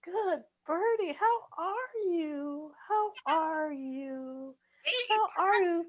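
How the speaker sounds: high, slow soft bird talk; baby talk to a bird. Acted. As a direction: coo gently at a little bird. Talking to bird on finger.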